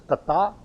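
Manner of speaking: "Datta" with the accent on the TA, rising; "Datta" stressed on its last syllable, checking the pronunciation; stress on final syllable. Shown as a demonstration. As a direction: lift the second syllable of "Datta" as a question. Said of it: "accent on the ta?".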